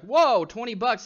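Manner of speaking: slow, loud, wide-eyed whoa; amazed whoa. Retold or imitated. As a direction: relive the first surprise at the money, slowly. Retelling his surprise at early earnings.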